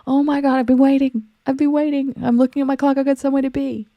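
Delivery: high, mock-exasperated complaint; impatient demanding. Imagined person hurrying the sunrise along, mock impatient. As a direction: act out someone fed up with waiting, pitched well up. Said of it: "demanding that the sun hurries up".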